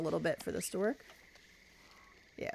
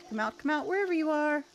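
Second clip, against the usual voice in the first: 1 singsong taunt; 7 semitones higher than usual. Pretend. Taunting hiding game character.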